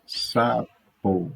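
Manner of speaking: SAPO split in two, sa-po; syllable by syllable. Shown as a demonstration. Teacher showing two syllables in Sapo, syllable by syllable. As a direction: say Sapo as two separated beats. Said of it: "Fale as palavras a seguir em voz alta".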